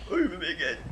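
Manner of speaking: normal talking voice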